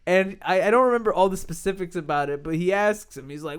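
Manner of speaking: normal talking voice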